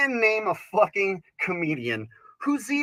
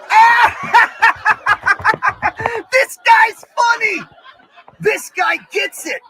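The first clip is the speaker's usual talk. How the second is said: very high, very loud laughing delight; laughing shout; 12 semitones higher, 10 dB louder than usual. Retold or imitated. Comic voicing himself loving the joke, laughing loudly. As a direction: cackle and shout it in glee. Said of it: "And I'll be like".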